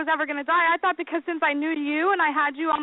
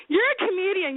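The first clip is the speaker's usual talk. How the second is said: ordinary talk, nothing marked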